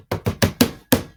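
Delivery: long exasperated exhale; frustrated sigh. Real, not acted. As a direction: sigh heavily at yourself. Gives up for a moment.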